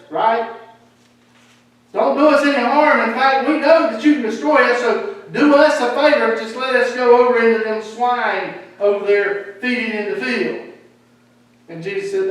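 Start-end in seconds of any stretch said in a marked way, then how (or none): 1.9-10.7 loud, high, urgent begging of a crowd of demons; pleading demons. Acted. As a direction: beg Jesus loudly to be sent into the swine. Demons begging Jesus in story.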